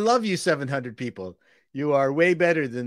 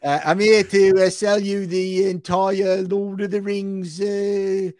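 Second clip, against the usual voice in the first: hammed-up fake British accent, higher and slow; a mock posh British pitch, higher and drawn out; terrible British accent; 6 semitones higher, 3 dB louder, slower than usual. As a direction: play a bad British salesman pitching to Bezos. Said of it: "terrible British accent".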